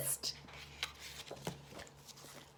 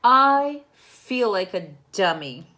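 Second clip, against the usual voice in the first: put-on loud, slow, upset cry of a boy; upset child; 3 semitones higher, 11 dB louder, slower than usual. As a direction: wail it out like a frustrated kid. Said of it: "said Victor".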